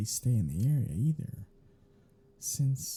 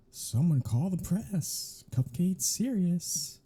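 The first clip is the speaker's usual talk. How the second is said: slow and higher, a sarcastic fake headline; mock announcement; 7 semitones higher, slower than usual. Vampire mocking the hunter for being serious, sarcastic. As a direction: announce it slowly like big news, all sarcasm.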